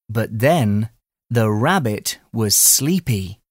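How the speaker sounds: ordinary delivery, nothing marked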